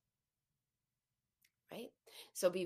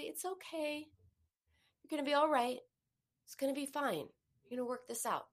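higher, caring reassurance; warm, different tone; 5 semitones higher, faster than usual. Shown as a demonstration. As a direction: lift "It's okay" gently and kindly. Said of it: "in a very different tone".

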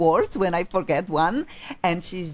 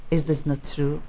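usual speaking voice

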